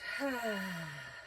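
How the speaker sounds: soft, long audible out-breath sigh; demonstrated sigh. Shown as a demonstration. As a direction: let the breath fall out with a sigh. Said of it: "Sigh it out".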